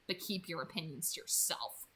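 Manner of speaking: quiet, sullen low grumble; sullen mutter. A narrator's reading. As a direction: mutter it under your breath, sulking. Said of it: "he muttered sullenly".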